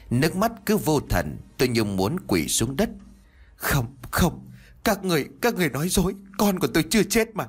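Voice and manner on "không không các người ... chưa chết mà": high, fast, rising cry of denial; desperate denial. A narrator's reading. Grieving mother telling the hospital staff they lie, high and frantic. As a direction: refuse it, voice climbing in panic. Mother denying the baby's death.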